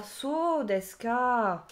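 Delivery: "so desu ka?" very slow, drawn out, pitch falling; slow falling reading. Shown as a demonstration. Teacher demonstrating how "so desu ka" is read, slowly. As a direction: stretch "so desu ka" and let it settle down. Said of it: "C'est un peu comme ça que ça se lit".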